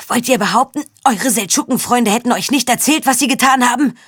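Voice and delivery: pinned man angrily challenging the knights; furious challenge. Acted. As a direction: throw the question at them in anger.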